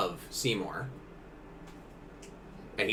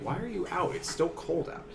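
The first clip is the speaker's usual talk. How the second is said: mock complaint; 8 semitones higher, 3 dB quieter than usual. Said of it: "You're just like".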